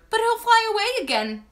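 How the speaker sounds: high, plaintive little voice; whimper. A narrator's reading. Henry afraid to lose the butterfly, whimper. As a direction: protest in a lightly acted whimper. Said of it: "Henry whimpered".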